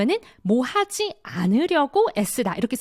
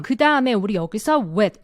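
usual speaking voice

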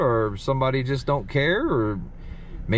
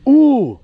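sharp high loud ooh of alarm; a jolt of real fright; startled ooh; 12 semitones higher, 10 dB louder than usual. Real, not acted. Ghost hunter hearing a male voice in the graveyard, startled high ooh. Reacts to a voice heard.

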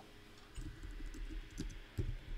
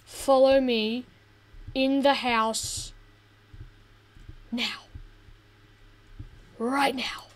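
firm order; slower than usual. Real, not acted. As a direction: spell it out like a stern parent. Ordering companion inside.

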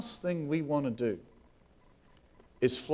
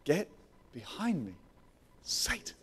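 quick hushed rebuke; 7 dB quieter than usual. Retold or imitated. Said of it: "quick as anything, just looks at him and says".

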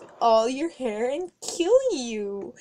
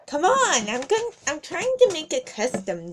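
usual speaking voice

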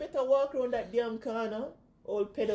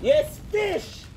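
usual speaking voice

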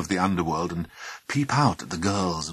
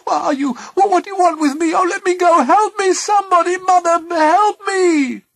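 high, loud panicked cries for help; crying out; 11 dB louder than usual. A narrator's reading. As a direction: shriek for rescue, pitch far up. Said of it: "she cried".

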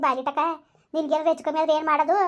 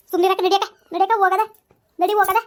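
much louder, pushing her to go; urgent command; 4 semitones higher, 7 dB louder than usual. Acted. Neighbour loudly hustles the weeping mother to leave. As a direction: hurry her out the door.

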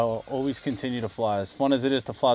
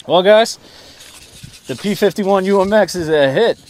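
excited announcement; 6 semitones higher, 10 dB louder than usual. Real, not acted. Enthusiastic verdict to viewers.

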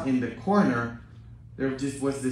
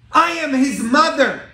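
loud, high cry of a grieving mother; anguished cry; 12 dB louder than usual. Retold or imitated. Mother pleading outside the prison, loud anguished cry. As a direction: cry out the words in pain. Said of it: "she said".

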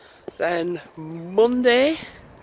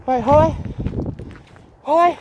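raised, higher call to the dog; 8 semitones higher, 5 dB louder than usual. Real, not acted. Calling her dog Holly back to her, loud.

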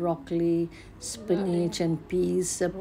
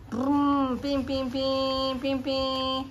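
high, playful engine and horn sounds; car noises; 8 semitones higher, much slower than usual. Pretend. Mother driving toy car for baby, playful beeping. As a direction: play at a car honking along.

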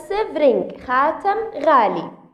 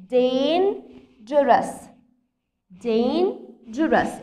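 slow modelling; much slower than usual. Shown as a demonstration. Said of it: "teacher models word pronunciation".

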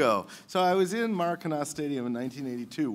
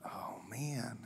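whispering; 5 semitones lower, 17 dB quieter than usual. Real, not acted. Contestant agonizing over choice.

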